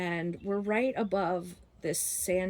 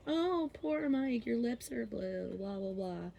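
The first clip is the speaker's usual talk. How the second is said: mock fawning; 5 semitones higher, 5 dB quieter than usual. Seniors fawning over the showered boy, mock cooing. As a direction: coo with exaggerated sympathy, fading away. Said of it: "fawning over him".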